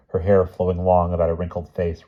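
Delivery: ordinary delivery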